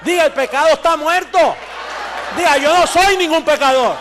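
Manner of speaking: preacher rousing the faithful, loud and fervent; full-force preaching. Acted. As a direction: drive it home from the pulpit.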